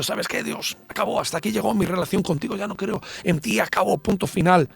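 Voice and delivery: high, hushed, hurried breakup with God; imagined renunciation. Pretend. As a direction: act out quietly calling it quits.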